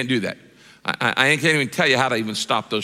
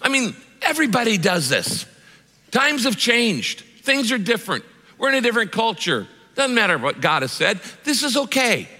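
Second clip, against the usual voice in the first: coaxing, higher, brushing it off; tempting Satan; 7 semitones higher than usual. Retold or imitated. Satan minimizing the sin to tempt the believer, coaxing. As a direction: sweet-talk them into the sin, lightly voiced. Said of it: "He goes".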